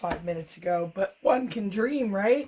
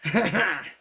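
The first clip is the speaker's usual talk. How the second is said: short amused chuckle; quick burst of laughter; brief laugh at her own joke; 3 dB louder than usual. Real, not acted.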